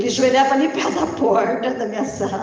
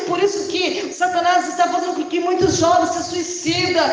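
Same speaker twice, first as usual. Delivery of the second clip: high, loud fiery preaching with shouts; impassioned shouted sermon building to a roar; preaching at full force; 8 semitones higher, 6 dB louder than usual. Acted. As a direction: thunder it out over the congregation like a revival preacher. Preaching climax to the church.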